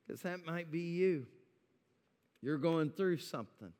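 ordinary talk

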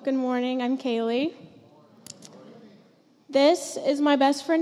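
usual speaking voice

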